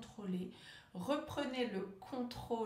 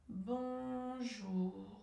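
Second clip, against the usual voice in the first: BONJOUR very slow, each syllable held on one level note; stretched syllables. Shown as a demonstration. Coach leads stuttering exercise after a breath, drawn-out BONJOUR. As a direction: hold BON long, glide evenly into a long JOUR. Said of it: "allonger le mot, allonger la syllabe".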